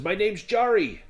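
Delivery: high, loud swaggering self-introduction; boastful dwarf Jari. Acted. Dwarf introducing himself to the tavern, loud and boastful. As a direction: crow your own titles proudly.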